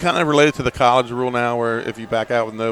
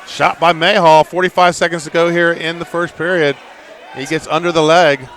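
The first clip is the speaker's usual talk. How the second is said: raised, projected wrestling commentary; live play-by-play; 5 semitones higher, 5 dB louder than usual. Real, not acted. Announcer calls a first-period shot to listeners, live play-by-play. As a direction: call the action with a higher pitch.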